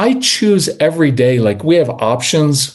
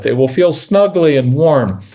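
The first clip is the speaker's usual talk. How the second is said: ordinary delivery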